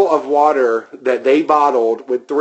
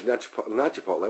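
self-correcting mutter; 4 semitones lower, 12 dB quieter, faster than usual. Real, not acted. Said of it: "What the fuck say Chipotle for".

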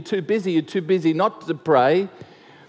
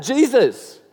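raised, higher call across a crowd; 6 semitones higher, 3 dB louder than usual. Retold or imitated. Blind Bartimaeus calling to Jesus from the roadside, raised call. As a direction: call his name to get attention. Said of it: "he calls out".

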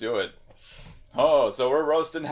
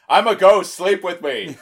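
very direct; 5 semitones higher, 9 dB louder than usual. Pretend. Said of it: "Very direct".

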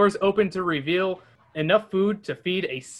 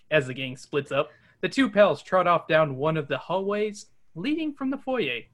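usual speaking voice

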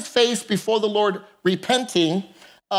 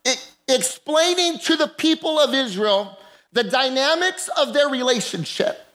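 normal talking voice

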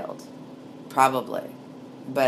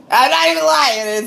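much higher, louder insistence; excited; 13 semitones higher, 10 dB louder than usual. Real, not acted. Insisting to listeners the story is true, excited loud. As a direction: swear to it, hyped up.

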